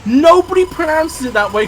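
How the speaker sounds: NOBODY hit high and loud; an exasperated high-pitched objection; emphatic protest. Real, not acted. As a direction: insist hard that nobody says it. Arguing over a pronunciation.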